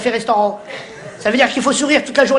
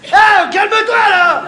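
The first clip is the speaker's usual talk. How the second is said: higher, louder snapping at the customer; comic waiter blowing up; mock angry outburst; 9 semitones higher, 5 dB louder, much slower than usual. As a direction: lose it at the customer. Waiter losing temper with customer.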